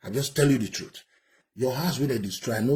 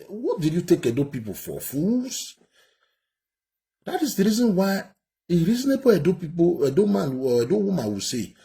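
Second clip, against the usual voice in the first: furious outrage; 7 semitones higher, 3 dB louder than usual. Real, not acted. Commentator rants at politician's video.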